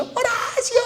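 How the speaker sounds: high, soft call from far away; faint distant call. Retold or imitated. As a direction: imitate a voice calling from far off. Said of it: "que escucho".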